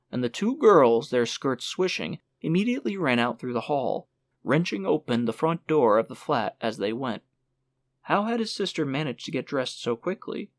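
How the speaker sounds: plain reading voice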